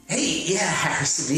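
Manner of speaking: normal talking voice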